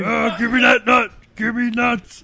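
high, loud, slow monster voice; drawn-out cartoon monster holler; bukkake monster. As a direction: demand it as a greedy comic monster. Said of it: "He's the bukkake monster".

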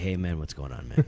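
very low, soft offstage imitation. Comic greeting someone backstage, low and soft. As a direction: drop right down, laid-back and subdued.

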